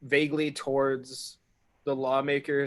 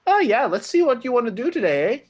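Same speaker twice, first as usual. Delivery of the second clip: cheeky invisible voice; 10 semitones higher, 10 dB louder than usual. Acted. Invisible character jokes.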